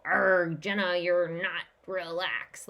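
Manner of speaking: mock growl, then a scolding; harsh, judgmental. Acting out harsh self-talk when not relaxed, mock judgmental scolding. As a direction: scold yourself loudly, hammed-up and harsh. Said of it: "That's harsh. That's judgmental".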